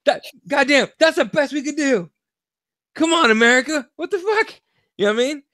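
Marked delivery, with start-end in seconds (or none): 0.0-3.9 mock despair. 4.0-4.5 high laughing what the fuck; high, laughing and stunned; laughing disbelief. Real, not acted.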